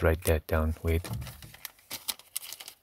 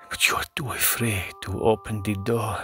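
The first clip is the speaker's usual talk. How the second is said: spooky whisper; 3 semitones higher than usual. Pretend.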